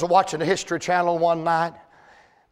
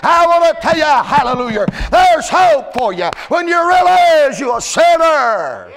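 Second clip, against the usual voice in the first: loud, higher preacher's shout; full-force preaching; 7 semitones higher, 6 dB louder than usual. Acted. Preacher shouting hope to the sinners in the room, loud and higher.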